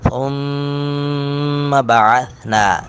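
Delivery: ثُمَّ with doubled میم held nasally; ghunna on mim. Shown as a demonstration. Teacher demonstrating ghunna on mushaddad mim. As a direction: hold the nasal in ثُمَّ, slowly. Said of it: "میم مشدد پر غنہ کریں گے".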